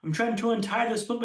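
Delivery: ordinary talk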